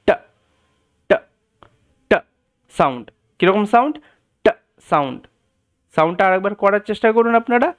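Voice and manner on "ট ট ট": ট said crisp and aspirated; English t demo. Shown as a demonstration. Teacher demonstrating the English t sound. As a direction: tap out a sharp ট. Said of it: "যে সাউন্ডটা হবে সেটা হচ্ছে".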